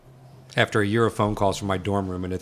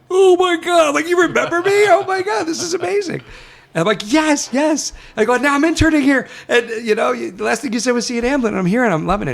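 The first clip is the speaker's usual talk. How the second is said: much higher, louder, gushing over each other; giddy fan excitement; 7 dB louder than usual. Retold or imitated. Intern gushing to Spielberg he remembers him, giddy high squeal. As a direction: squeal it like a starstruck kid meeting his hero.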